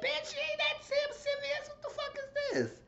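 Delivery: shrill-high put-on voice, mocking her. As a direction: squeak it out way up high like a caricature. Mocking reality show cast member.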